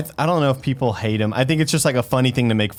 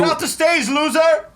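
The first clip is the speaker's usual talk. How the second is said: put-on loud drunk heckling. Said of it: "I wanted to yell that so bad".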